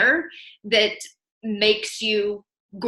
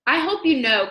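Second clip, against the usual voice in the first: ordinary delivery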